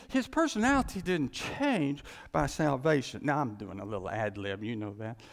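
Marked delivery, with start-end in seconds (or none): none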